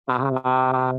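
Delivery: A held high and level; first tone A. Shown as a demonstration. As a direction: draw out A on one high, unchanging note. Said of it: "nada yang pertama itu nada yang datar". (A dengan nada pertama, tinggi datar)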